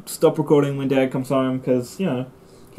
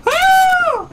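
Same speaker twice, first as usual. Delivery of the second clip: high-pitched startled "ahh"; long high yelp; startled cry; 4 dB louder than usual. Real, not acted. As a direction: cry out high in sudden alarm. Startled yelp during gameplay.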